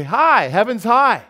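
ringing slow greeting, loud and high; joyful proclamation. Retold or imitated. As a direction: call it out like good news. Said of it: "so they say".

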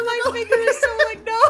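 high, squealing laughter through words; laughing. Real, not acted. Laughing while mashing.

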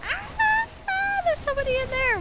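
high, loud cry of surprise; startled cry. Real, not acted. Player finding enemy inside, high startled cry. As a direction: jump out of your skin.

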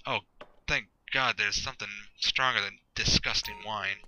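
usual speaking voice

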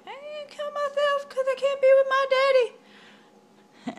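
put-on high girl voice. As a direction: squeak it like a tiny distraught girl. Girl voiced in retold myth.

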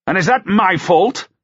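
high, indignant outburst; narrated shout, pitch leaping up. A narrator's reading. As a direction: cry out in angry protest. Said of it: "Lancaster shouted".

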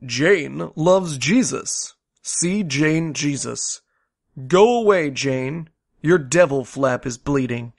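slow, soft, deliberate reading; mock primer reading. Parody McGuffey Reader lesson, slow deliberate reading. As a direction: read it like a child's first reader.

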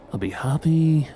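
ordinary delivery

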